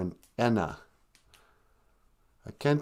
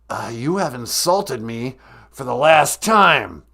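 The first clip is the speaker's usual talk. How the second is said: slow, put-on gruff caller voice; menacing caller voice; 4 dB louder than usual. A pretend caller threatens the podcast host in a slow put-on voice. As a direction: growl it out like a villain.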